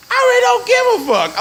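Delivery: very high-pitched exclamation; emphatic dismissal. Real, not acted. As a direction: voice shoots way up, not caring. Emphatic dismissal after exaggerated list.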